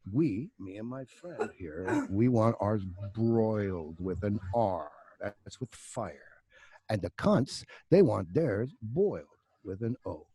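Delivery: drawn-out, over-careful imitation of a demanding customer; slow, deliberate ordering. Biker tells his waiter how to cook the lobsters, slow and deliberate. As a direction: spell it out slowly, word by word.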